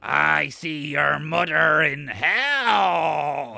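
slow, eerie, drawn-out ghostly taunt; creepy ghost voice. Retold or imitated. Retelling the ghost's threat in a slow eerie voice. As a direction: drag it out like a possessed man. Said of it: "the guy's like".

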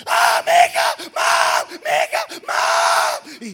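very high, loud desperate cries; retold mother and child screaming; desperate screams. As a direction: scream for your daughter, holding on. Mother clinging to car door.

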